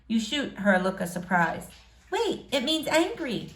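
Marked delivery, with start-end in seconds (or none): 2.1-3.5 put-on higher girlish voice; a young girl correcting herself. April voiced.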